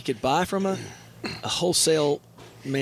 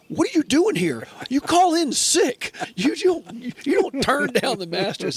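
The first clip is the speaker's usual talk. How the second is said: much higher and louder, slow on call in sick; incredulous coworker; 12 semitones higher, 5 dB louder, slower than usual. Retold or imitated. Coworker urges him to call in sick, incredulous yell. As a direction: scold him in disbelief. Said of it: "he goes".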